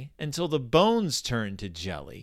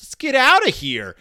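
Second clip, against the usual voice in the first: mock alarm; 11 semitones higher, 8 dB louder than usual. Mock panicked retreat from house.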